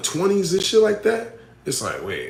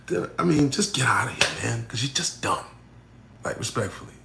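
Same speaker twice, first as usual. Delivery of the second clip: soft, hushed put-down; whispered, scornful aside; dismissive whisper; 4 semitones lower, 6 dB quieter than usual. Real, not acted. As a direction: drop to a whisper to brush them off. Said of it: "why y'all gotta make me talk like that".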